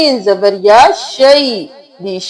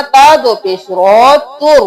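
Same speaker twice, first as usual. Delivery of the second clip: ordinary talk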